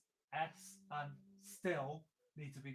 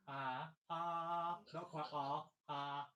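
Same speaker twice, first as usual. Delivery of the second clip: ordinary delivery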